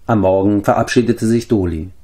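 ordinary delivery

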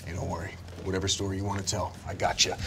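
low, very soft confiding voice; hushed reassurance. Acted. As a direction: murmur it privately, just between you two. Gunthar privately reassures player.

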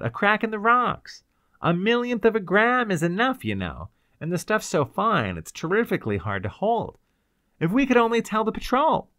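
high, urgent explanation; earnest young man. Acted. Fairchild explains the danger to a fellow prisoner, earnest and high.

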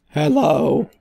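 lightly put-on lady's hello. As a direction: do a slight mock lady greeting. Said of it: "That's her voice".

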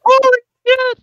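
gasping high-pitched exclamation; shocked gasp. Real, not acted. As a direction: react in sudden shock. Gasps at the swing.

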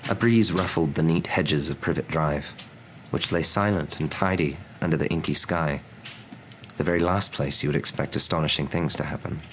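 ordinary delivery, nothing marked

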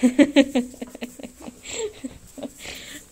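loud burst of laughter. Real, not acted. Laughs playing with dog.

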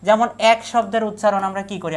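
ordinary talk

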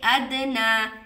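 अदना with ना stretched for two counts; long final vowel. Shown as a demonstration. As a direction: hold the ना in अदना. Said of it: "दो हरकत लंबा करके". (अदना, ना लंबा खींचें)

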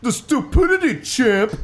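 very high, loud put-on squawk; mock it at the top of the pitch; hammed-up, squeaky scorn; exclaimed retort. A narrator's reading. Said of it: "I repeated".

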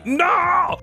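sudden high shouted no of dismay; shocked no. Real, not acted. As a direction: yelp it out as the move fails. Exclaims at failed move.

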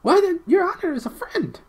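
very high, loud squeaky voice; relieved gnome. A narrator's reading. Gnome learns his captor killed the witch, relieved. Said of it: "gave a huge sigh of relief".

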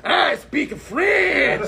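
very slow, high, mock-French shout; exaggerated French accent. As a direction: stretch it out in an over-the-top French accent. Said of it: "is more like this".

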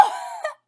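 short, high-pitched burst of laughter; sudden real giggle. Real, not acted. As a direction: let out a quick, high laugh.

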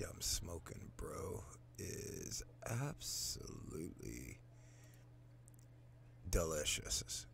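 drawn-out hushed whisper; slow whispered relish. Real, not acted. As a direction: savor the word in a whisper. Savoring the weed.

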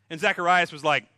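ordinary delivery

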